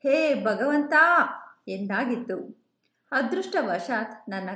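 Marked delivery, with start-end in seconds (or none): 0.0-0.9 sudden raised cry to God, higher and louder; frightened cry. A narrator's reading. Driver crying out to God as the car swerves, frightened. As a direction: blurt it out in panic as the car skids. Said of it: "ಆಗ ಉದ್ಗರಿಸಿದ್ದು".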